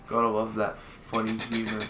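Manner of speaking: ordinary talk, nothing marked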